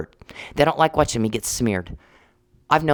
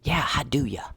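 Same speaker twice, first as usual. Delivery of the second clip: whisper; 3 semitones higher, 5 dB quieter than usual. Real, not acted. Mocking aside.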